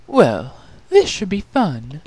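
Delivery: mock the whole errand; sarcastic snort. Chrysalis mocking the quest to her sisters, sarcastic. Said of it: "Chrysalis snorted".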